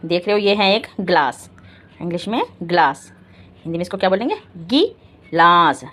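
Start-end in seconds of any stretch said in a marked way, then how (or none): 4.6-5.8 drawn-out, careful syllables; slow spelled-out word for kids; slow teaching. Shown as a demonstration. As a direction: stretch each sound for the class. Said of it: "ई का sound आएगा".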